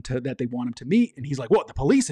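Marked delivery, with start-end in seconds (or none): 1.5-2.1 slightly raised, startled question; a touch of jittery alarm, retold; nervous. Butcher hears of a meeting and asks about police, nervous. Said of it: "he gets all nervous".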